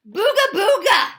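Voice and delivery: loud, slow, high booga scream. A narrator's reading. Batter screaming in the pitcher's face, high and loud. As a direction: scream it right in his face to spook him. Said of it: "I screamed in his face".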